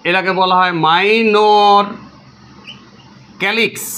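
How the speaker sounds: "Minor calyx" said very slowly, word by word, so it can be written down; slow dictation. Shown as a demonstration. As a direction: spell it out slowly for the class to copy. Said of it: "লেখো সবাই". (ধীরে ধীরে বলে লেখানো, "minor" টেনে টেনে)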